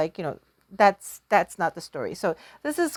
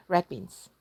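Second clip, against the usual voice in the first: ordinary delivery, nothing marked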